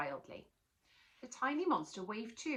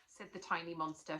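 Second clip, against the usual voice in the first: ordinary delivery, nothing marked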